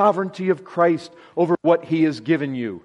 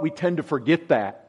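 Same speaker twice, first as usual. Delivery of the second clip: ordinary talk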